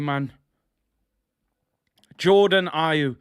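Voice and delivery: ordinary talk, nothing marked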